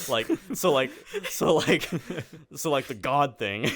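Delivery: giggling through the words; laughing. Real, not acted. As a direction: laugh while you talk. Laughing while recalling show segments.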